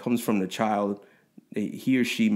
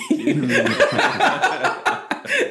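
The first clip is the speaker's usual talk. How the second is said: whispered giggle; 4 dB louder than usual. Real, not acted. Laughs after journal quote.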